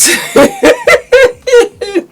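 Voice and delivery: real burst of high giggling; high-pitched laugh. Real, not acted. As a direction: break into a long, very high laugh. Host bursts out laughing mid-sentence.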